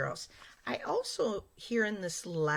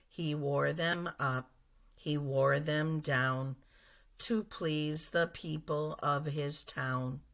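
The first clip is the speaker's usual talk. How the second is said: flat robotic reading; 2 semitones lower than usual. Shown as a demonstration. Teacher showing kids a monotone reading. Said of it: "I'm not gonna use any pitch or expression".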